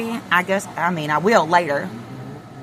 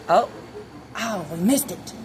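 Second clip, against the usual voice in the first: ordinary talk, nothing marked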